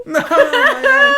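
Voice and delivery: high, loud, slow no through real laughter; laughing no. Real, not acted. Cohost laughs off losing an egg, slow and high. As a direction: laugh it out, pleading.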